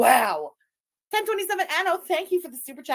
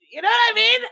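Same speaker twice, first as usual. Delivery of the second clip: louder, higher, delighted laugh; gleeful outburst; 9 semitones higher, 8 dB louder than usual. Real, not acted. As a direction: burst out with glee. Streamer gleeful over clip.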